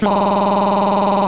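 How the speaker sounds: a drawn-out hammed-up moan; mock moan. Describing how his fantasy will sound, a hammed-up moan. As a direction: fake a long pleasured moan. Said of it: "it will sound like".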